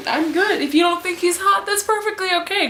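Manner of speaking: quick, high, airy dismissal; breezy defensiveness. Real, not acted. Fan defending her crush to doubters, breezy. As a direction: wave the doubters off, pitched high.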